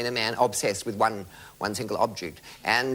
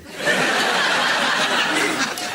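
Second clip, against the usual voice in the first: laughing; 5 dB louder than usual. Real, not acted. Long unvoiced laugh.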